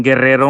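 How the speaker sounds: Guerrero with a trilled double R; rolled R's. Shown as a demonstration. Giving another example of rolled R's, Guerrero with a Spanish trill. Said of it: "It's like, uh, saying".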